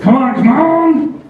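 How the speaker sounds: high, slow, drawn-out pleading; slow impatient plea. Retold or imitated. Comic waiting in line, high slow impatient pleading. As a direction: stretch it out, begging the line to move. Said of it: "I'm just like".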